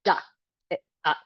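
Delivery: duck broken into d, uck; mock child mistake; split duck, child's error. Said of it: "children will do something like this".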